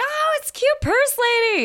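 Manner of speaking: very high, loud delighted squeal; gushing greeting. Retold or imitated. Checkout people spotting a regular, squealed excited greeting.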